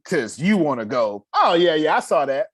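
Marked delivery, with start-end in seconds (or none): 1.3-2.5 quick, louder imitation of a brush-off; mock offhand friend. Mimicking a dismissive friend.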